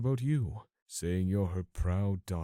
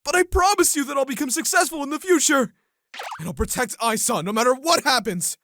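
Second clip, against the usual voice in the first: loud, high earnest vow; earnest plea; 9 dB louder than usual. Acted. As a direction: promise her mother with all your heart. Fiancé pleads for marriage.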